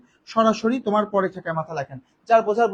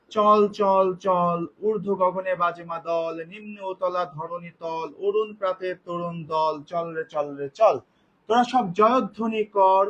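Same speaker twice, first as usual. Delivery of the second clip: slow, measured recital of a rousing poem; poem recitation; 4 semitones lower, 3 dB quieter, slower than usual. Shown as a demonstration. Teacher reciting a Nazrul marching poem to students, slow and measured. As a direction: declaim each line slowly like a stage recitation.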